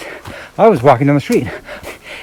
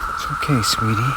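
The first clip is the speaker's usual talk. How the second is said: soft reassuring; 7 dB quieter than usual. Real, not acted. Soothing an animal on the trail.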